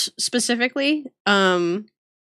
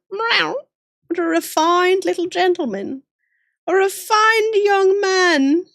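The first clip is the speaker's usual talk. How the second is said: high, prissy cat voice, much higher, the last line slow; voicing the cat; 8 semitones higher, slower than usual. Voicing the cat Jackie, praising a refined gentleman in a high voice. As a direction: coo like a fussy posh cat. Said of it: "Jackie says".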